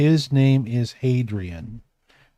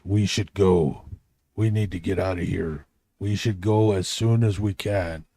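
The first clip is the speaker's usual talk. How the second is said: big man; 6 semitones lower, 3 dB quieter than usual. Acted. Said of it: "the really big one".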